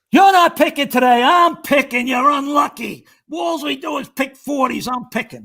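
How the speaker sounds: high, insistent chauffeur's protest; mock quarrel. Chauffeur refusing to let an unlucky man pick, mock indignant. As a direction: argue loudly, pitched right up. Chauffeur argues over who picks.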